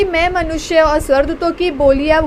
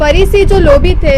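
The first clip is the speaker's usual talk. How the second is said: loud reading; 7 dB louder than usual. Real, not acted. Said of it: "बस, repeat".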